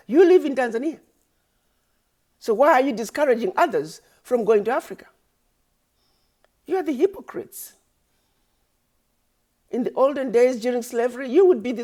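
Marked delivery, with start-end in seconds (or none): 0.1-7.7 raised, higher pitched and accusing; angry accusation. Real, not acted. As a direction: call them hypocrites with real heat. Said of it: "I'm sorry for being angry".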